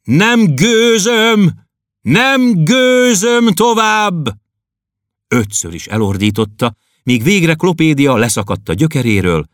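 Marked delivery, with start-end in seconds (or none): 0.0-4.4 high, slow, drawn-out bellow; Berzsián roaring out his sorrow; anguished roar. Acted. Poet roaring his grief alone at home, slow high bellow. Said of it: "ezt ordította".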